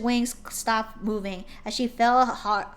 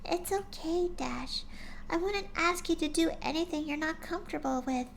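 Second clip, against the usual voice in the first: higher, softer timid pony voice; gentle shy pony; 8 semitones higher, 4 dB quieter than usual. A narrator's reading. Shy pony gently coaxing her friend, soft and high. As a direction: speak like a shy gentle mare.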